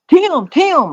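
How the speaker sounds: put-on high, young kid's call; acting like a kid. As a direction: call out like a fourteen-year-old. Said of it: "I would act like a kid".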